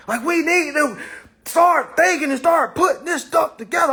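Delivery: coach yelling. Retold or imitated. Coach barking orders at a room of old heads.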